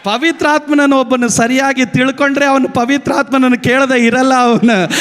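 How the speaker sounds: ordinary talk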